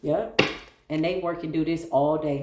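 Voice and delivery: ordinary talk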